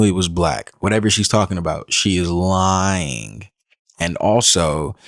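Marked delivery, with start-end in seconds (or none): 1.9-3.5 slow emphatic. Real, not acted. Commentator slowly stresses accusation.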